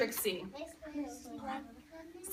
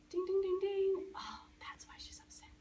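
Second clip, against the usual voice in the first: realizing dad; 5 semitones higher, 6 dB quieter than usual. Retold or imitated. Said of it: "Dad's like".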